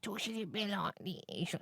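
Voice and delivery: slow, mumbled mock film voice; Tom Hardy. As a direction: mumble through a daft impression. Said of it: "what he does with this one".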